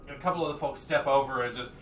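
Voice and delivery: usual speaking voice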